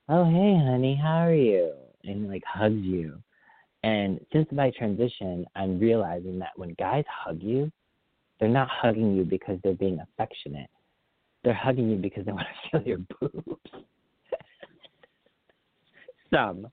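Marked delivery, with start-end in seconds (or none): 0.1-1.8 overly familiar uncle greeting at a party; creepy uncle. Acted. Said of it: "like, a creepy uncle". 12.3-16.7 breaking into a laugh mid-sentence; real laugh at your own remark. Real, not acted.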